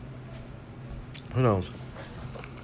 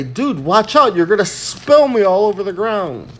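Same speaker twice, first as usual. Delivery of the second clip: freaking out; 7 semitones higher, 6 dB louder than usual. Retold or imitated. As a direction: panicky yelp, higher and louder. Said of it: "he would freak out".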